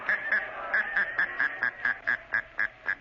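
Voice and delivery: eerie mocking laugh of the unseen Shadow; a slow taunting laugh that fills the dark; sinister laugh. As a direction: laugh to unnerve the sniper. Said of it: "Who laughed like that?".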